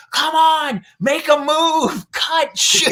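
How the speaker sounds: high, full-volume coaching yell; urgent drawn-out shouts across the field; sideline coach shouting. Retold or imitated. Soccer coach urging players on from the sideline, shouting.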